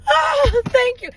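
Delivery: high, loud re-enacted relief; dramatic relieved outburst; huge relief. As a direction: gush thanks as if rescued. Re-enacting relief at the officer's news.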